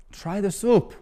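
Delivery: a hint of a demanding regular; insistent old man. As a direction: press him to taste it. Eric insisting again.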